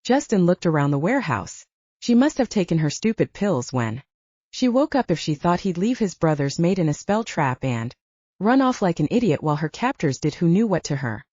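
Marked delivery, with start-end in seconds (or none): none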